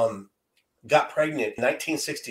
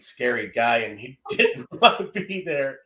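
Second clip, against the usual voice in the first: ordinary delivery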